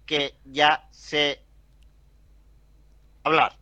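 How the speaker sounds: slow, flat speech-synthesizer voice. Pretend. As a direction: speak like an old text-to-speech machine. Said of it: "concurso mundial de imitadores de festival".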